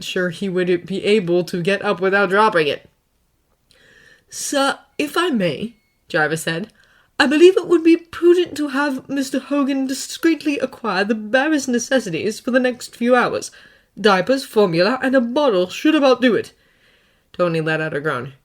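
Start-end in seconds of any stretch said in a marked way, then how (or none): 4.3-5.7 higher, slow, deferential butler voice; polite AI butler. Acted. Jarvis politely interrupting Tony, slow and deferential. 7.2-16.5 higher, measured butler voice; polite AI butler. Acted. Jarvis advising Tony on baby supplies, measured and polite.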